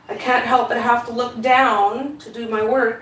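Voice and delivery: ordinary delivery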